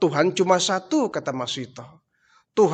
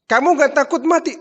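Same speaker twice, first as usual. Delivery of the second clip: incredulous outburst; 10 semitones higher, 5 dB louder than usual. Acted. Pharaoh incredulous at defiance.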